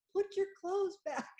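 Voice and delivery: high-pitched, laughing through the words; laughing quote. Retold or imitated. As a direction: blurt it out while cracking up. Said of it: "when we're all like".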